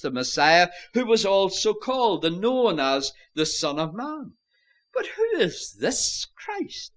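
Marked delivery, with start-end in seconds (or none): 4.9-6.9 high, slow question leaning on "this"; stress on THIS. Shown as a demonstration. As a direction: stretch out "this" in a high-pitched, pointed question. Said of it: "place the emphasis on that word".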